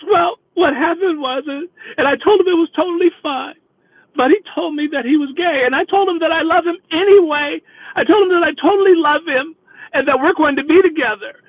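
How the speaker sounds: high-pitched, hammed-up tearful voice; mock weepy explanation. Dumped passenger explaining about her boyfriend, mock weepy. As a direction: imitate her pleading through tears, pitched high. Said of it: "she is boo-hooing back there".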